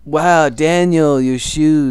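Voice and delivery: loud, high, drawn-out mock amazement; exaggerated high-pitched admiration; meme imitation. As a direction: stretch it out like a meme quote. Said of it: "are you talking about the".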